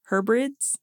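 "Hebrides" tried as a rising question; unsure questioning rise. Real, not acted. Host retrying the island name, "Hebrides" as unsure question. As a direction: say "Hebrides" like a second guess.

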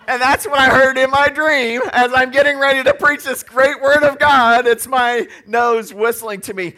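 loud laughing preacher; real loud laughter through the words. Real, not acted. Laughing at own dream.

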